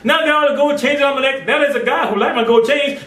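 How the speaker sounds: ordinary talk, nothing marked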